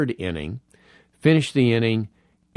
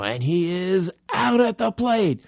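Vin Scully call; 7 semitones higher than usual. Retold or imitated. Retelling sitting beside the legend, imitating his call. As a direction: lightly imitate a veteran announcer's great call. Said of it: "Scully had typical great call".

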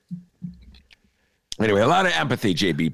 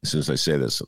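usual speaking voice

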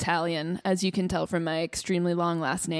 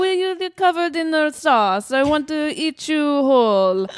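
higher, louder playful gushing; gushing Italian admirer; 7 semitones higher, 6 dB louder than usual. Pretend. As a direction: dote on them hungrily. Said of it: "if someone's like".

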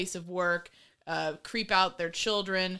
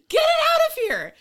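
much higher and louder, fed-up outburst; real, joking outrage at the screen; exasperated shout; 8 dB louder than usual. Real, not acted. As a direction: yell it like you can't stand another second. Exasperated at the parade scene.

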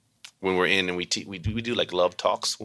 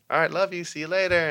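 cheery goodbye; 11 semitones higher than usual. Retold or imitated.

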